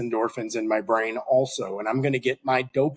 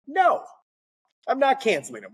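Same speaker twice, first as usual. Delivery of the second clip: excited refusal; 12 semitones higher than usual. Retold or imitated. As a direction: refuse with gleeful mock outrage. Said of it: "I'm like".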